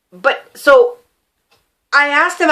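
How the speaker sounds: ordinary delivery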